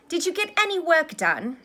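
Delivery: high, loud, fretful question; anxious. A narrator's reading. Hermione checking whether Harry did his work, anxious. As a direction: press with nervous concern. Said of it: "looking anxious".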